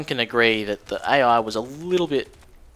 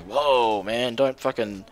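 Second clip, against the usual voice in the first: hippie on drugs; slower than usual. Retold or imitated. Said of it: "sounded like he was a hippie on drugs".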